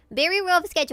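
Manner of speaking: loud, mock defensive; loud protest. Real, not acted. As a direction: blurt it out defensively. Excuses her rough drawing.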